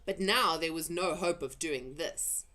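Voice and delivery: ordinary delivery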